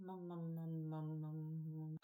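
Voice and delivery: ordinary delivery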